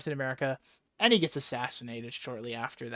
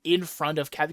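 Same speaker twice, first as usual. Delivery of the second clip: usual speaking voice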